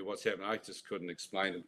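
usual speaking voice

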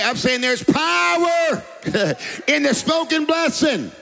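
preacher's shout. Real, not acted. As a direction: cry it out to rouse the congregation. Preacher crying out to congregation.

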